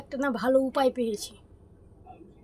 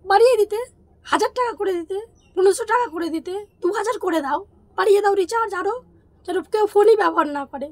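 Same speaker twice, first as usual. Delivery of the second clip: indignant sarcasm with raised voice; sarcastic urging; 4 semitones higher, 6 dB louder than usual. Acted. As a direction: egg them on in angry mockery. Sarcastically urging bigger price hikes. (ব্যঙ্গ মেশানো রাগী সুর)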